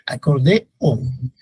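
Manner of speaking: "Acordeón" drawn out syllable by syllable, a-cor-de-ón; teacher separating the vowels slowly. Shown as a demonstration. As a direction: spell out "Acordeón" slowly for the class. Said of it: "todas esas son hiatos".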